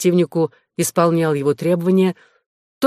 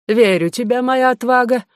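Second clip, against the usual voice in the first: lightly mannered, higher-pitched gentleman; affected tone; 7 semitones higher, 3 dB louder than usual. A narrator's reading. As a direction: address him with a touch of dandyish affectation. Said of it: "возвращаясь к своему прежнему жеманному тону". (слегка жеманный тон)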